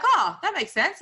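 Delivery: "Oh, that makes sense" high and loud, bright Oh; a burst of realization on "Oh"; sudden realization. Retold or imitated. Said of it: "it was like".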